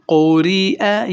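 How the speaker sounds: slow قوری اے with a thin را and the اے stretched; slow recitation. Shown as a demonstration. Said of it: "یہ را باریک آ رہی ہے". (باریک را کے ساتھ آہستہ قوری اے)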